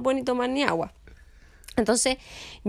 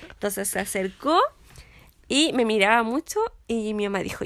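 usual speaking voice

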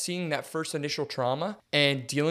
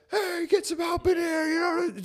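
much higher, shouting for help in mock panic; mock panicked shout. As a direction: yell it like the guy who freaks out. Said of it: "I'm not the kind of guy that just says".

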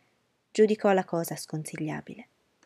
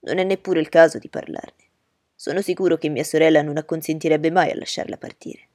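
put-on louder, eager young gentleman; Bingley's voice; 9 dB louder than usual. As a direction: reassure her warmly and firmly.

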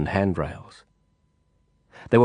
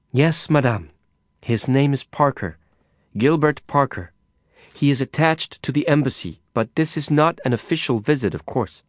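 deferential; 5 semitones higher than usual. Acted. Carla answering Madame Zhou.

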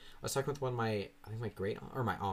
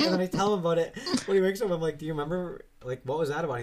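excited; 8 semitones higher, 8 dB louder, faster than usual. Real, not acted. Excited retelling, raised voice.

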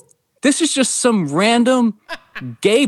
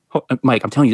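ordinary talk, nothing marked

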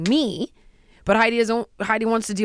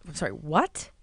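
incredulous; 7 semitones lower, 6 dB quieter than usual. Retold or imitated. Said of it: "I go".

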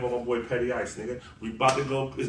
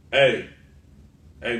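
ordinary talk

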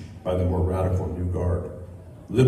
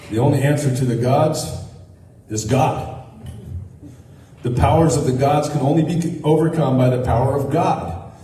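preaching at full force; 4 semitones higher than usual. Acted. Preacher telling his congregation that Jesus is the answer, full-force preaching. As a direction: proclaim it like the altar call is coming.

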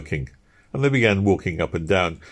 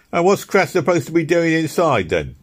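higher, louder questioning workman; a sharp, pitched-up question; Easton's voice; 11 semitones higher, 5 dB louder than usual. Acted. Easton asking the others about Crass, pitched up.

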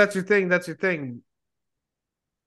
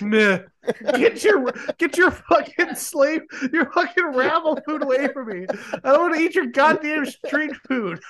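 snobby disgusted voice; 10 semitones higher than usual. Retold or imitated. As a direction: sneer like a spoiled rich snob. Imitating snob refusing food.

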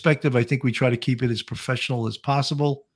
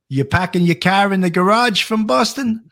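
hammed-up Boston accent, higher; mock Boston accent; 6 semitones higher than usual. Teasing a viewer with a mock Boston accent. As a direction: joke Boston accent, dropping the r's. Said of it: "that's a terrible Boston accent".

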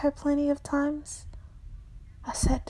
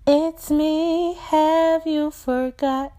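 slow, higher plea, vowels held; crying out; 5 semitones higher, slower than usual. Acted. Strange voice repeating itself to the narrator, slow cry. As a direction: call out pleadingly, stretching the words. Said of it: "She cries out".

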